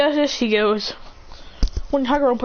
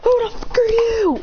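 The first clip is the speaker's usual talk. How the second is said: much higher, alarmed outcry; startled shout; 12 semitones higher than usual. Acted. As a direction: jump at the strangers. Startled by figures appearing.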